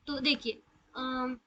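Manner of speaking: usual speaking voice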